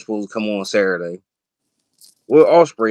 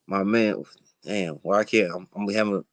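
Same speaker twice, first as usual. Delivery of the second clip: tipsy; 6 semitones lower, 9 dB quieter than usual. Real, not acted. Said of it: "I'm a little tipsy right now".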